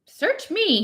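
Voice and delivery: high, fed-up brush-off; an irritated shrug in the voice; irritable. A narrator's reading. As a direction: toss it off with irritation. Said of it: "he said irritably".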